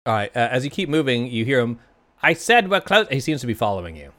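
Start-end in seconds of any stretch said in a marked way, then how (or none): 2.2-3.1 calling after. Acted. Barkeep calls after them.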